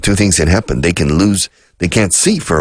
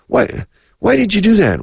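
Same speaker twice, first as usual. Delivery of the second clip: mock accusing question. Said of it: "dialogue example, the accuser".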